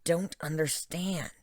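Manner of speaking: plain reading voice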